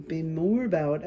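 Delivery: sudden slip into a light Irish lilt, slowed; Irish accent. Real, not acted. Tarot reader to viewers, accidental Irish accent. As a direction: let an Irish accent creep in unbidden. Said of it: "I don't know where that Irish accent came from".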